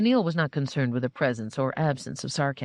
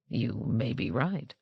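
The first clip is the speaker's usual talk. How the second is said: soft, slow and lower, pondering it; thoughtful; 5 semitones lower, 5 dB quieter, slower than usual. A narrator's reading. Captain conceding the robot's point, soft and slow. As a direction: let it sink in, quiet and slow. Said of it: "D G said thoughtfully".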